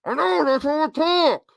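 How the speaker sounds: words slurred together, hard to understand; mumbled voice. Pretend. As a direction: mumble it like a mouthful. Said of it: "I can't understand you".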